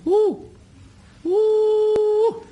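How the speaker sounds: high falsetto hooting woo calls; loud high-pitched call into the night; bunyip mating call. Shown as a demonstration. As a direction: hoot it like an animal calling in the bush. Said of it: "It's like this".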